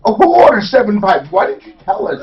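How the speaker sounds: mock exasperated call. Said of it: "We're like".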